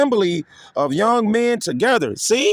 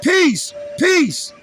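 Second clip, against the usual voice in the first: mock peace-sayers; 7 semitones higher than usual.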